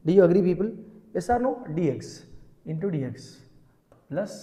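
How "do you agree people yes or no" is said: loud call to the class. Real, not acted. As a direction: raise your voice to the students. Teacher calling out to class.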